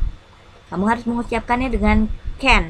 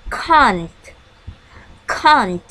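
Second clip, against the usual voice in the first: can't with the British long a vowel; British English can't with the long a; British pronunciation. Shown as a demonstration. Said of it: "This is British pronunciation".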